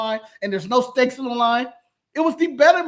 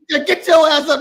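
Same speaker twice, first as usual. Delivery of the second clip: loud, higher-pitched mock yell; retold shout; 6 semitones higher, 6 dB louder than usual. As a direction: holler it like a rowdy order. Acting out a yelled command.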